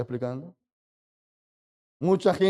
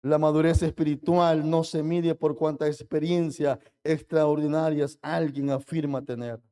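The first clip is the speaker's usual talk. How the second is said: ordinary delivery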